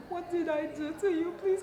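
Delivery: ordinary delivery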